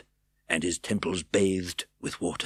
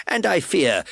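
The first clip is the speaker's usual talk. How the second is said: energetic plea; 10 semitones higher, 8 dB louder than usual. A narrator's reading. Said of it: "he added with great energy".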